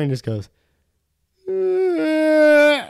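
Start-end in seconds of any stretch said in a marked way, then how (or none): 1.6-2.9 high, drawn-out imitated cry; long fake whine; mock crying wail. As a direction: imitate someone starting to bawl. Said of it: "What the fuck are you crying for".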